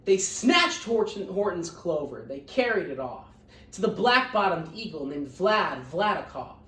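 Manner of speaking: lively read-aloud storytelling; picture book reading voice; story narration. A narrator's reading. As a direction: read it to the class with bounce. Teacher reads picture book aloud.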